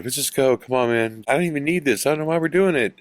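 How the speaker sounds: lightly voiced nervous plea to leave; scared pleading. Scared friend begging to leave.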